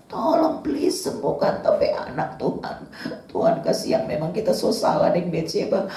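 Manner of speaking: pleading prayer of a grieving king; David's anguished plea. Retold or imitated. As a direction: beg God for your child's life. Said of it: "pilu sekali Daud, bergumul".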